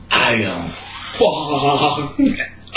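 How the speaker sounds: very slow, dragged-out confession; slurring, stoned and half crying. Retold or imitated. Confessing being high.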